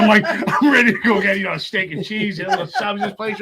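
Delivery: high, loud, gleeful food list; excited craving. Retold or imitated. Speaker relives sudden junk food cravings in rehab, excited.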